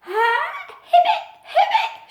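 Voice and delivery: very high squeaky attempt at a croak; high laughing try at a croak; failed croak. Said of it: "she croaked".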